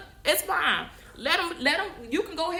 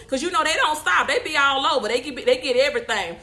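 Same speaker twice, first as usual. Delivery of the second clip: normal talking voice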